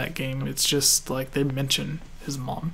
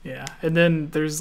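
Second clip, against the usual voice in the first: ordinary talk, nothing marked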